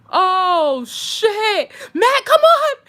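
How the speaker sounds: high, loud, urgent shout, acted out; alarmed yell. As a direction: yell for your friend to flee. Retold shock, yelling to friend.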